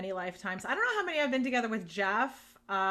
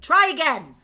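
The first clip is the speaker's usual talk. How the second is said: loud, high mock reprimand; scolding; 6 semitones higher, 10 dB louder than usual. A higher power sends back a failed soul, mock scolding. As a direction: bark it as a retold telling-off. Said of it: "I was scolded".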